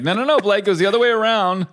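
hammed-up correction of the kid; mock high-pitched protest. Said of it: "and you're like".

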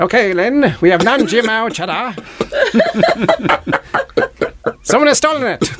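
put-on high, theatrical old-time radio mystery voice; 1920s voice. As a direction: ham it up like a 1920s radio detective. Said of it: "talk in a nineteen twenties voice".

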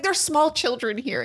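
high-pitched indignant scolding; retold indignant yelling. Holly scolding boys in front of small children, indignant yelling. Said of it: "I was yelling at them".

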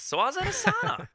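very high comic voice with a laugh; silly sauna voice. Acted. Teller reviving his sauna joke, silly high voice and laughing. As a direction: send it way up, breaking into laughter.

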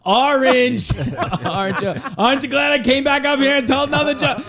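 put-on high, loud kid punchline with laughs; a kid cracking himself up; gleeful kid, laughing through. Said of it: "And he goes".

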